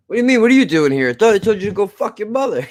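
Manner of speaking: mock mobster taunt. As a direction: sneer the line at him then crack up. Retelling a Goodfellas bar scene.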